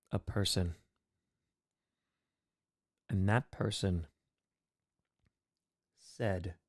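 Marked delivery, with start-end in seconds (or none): none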